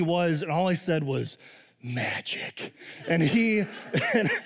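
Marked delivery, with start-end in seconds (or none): 1.8-2.7 soft, secretive whisper. Acted. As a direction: breathe it like a wonderful secret. Telling toddler about Disney.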